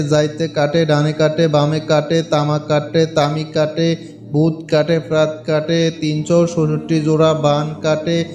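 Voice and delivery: slow, solemn chant of a spell, low and loud; mantra recitation. Shown as a demonstration. Vlogger reciting the mantra for viewers, slow incantation chant. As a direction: intone each line like an incantation. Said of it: "মন্ত্রটা আমি আপনাদেরকে উচ্চারণ করে শোনাচ্ছি".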